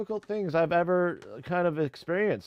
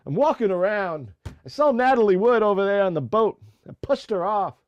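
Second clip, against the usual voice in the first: loud, higher-pitched celebrity impression; Christopher Walken; 6 semitones higher, 7 dB louder than usual. Streamer doing an unfinished impersonation, loud lifted actor voice. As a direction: project a put-on actor voice, pitched up. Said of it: "my Christopher Walken".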